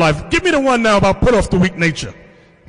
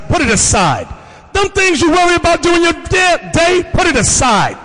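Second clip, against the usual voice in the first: preaching; 8 semitones higher than usual. Real, not acted. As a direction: drive it home. Preaching at full force.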